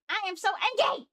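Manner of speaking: high-pitched exasperated yell; fed-up cry of frustration; angry outburst. Real, not acted. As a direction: burst out, voice jumping up on the last word.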